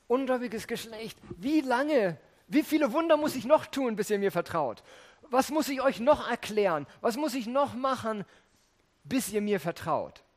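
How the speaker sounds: Jesus pressing his faithless listeners hard; exasperated rebuke. Retold or imitated. Preacher voicing Jesus, exasperated and loud. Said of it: "nicht einfach nur einen Vorwurf".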